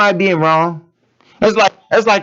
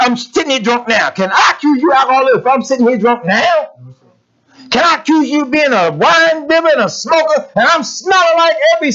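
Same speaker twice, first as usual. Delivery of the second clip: fiery preaching; 6 semitones higher than usual. Acted. Preacher rebuking his congregation, building to a shout. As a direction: build to a shouting call-out of the flock.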